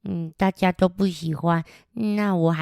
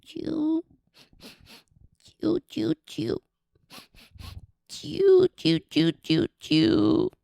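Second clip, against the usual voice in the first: bird crying chirps; 9 semitones higher, much slower than usual. Acted. 有个声音这样子. (小鸟哭泣的啾啾声)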